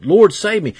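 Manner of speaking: ordinary talk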